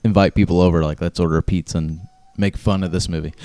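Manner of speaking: usual speaking voice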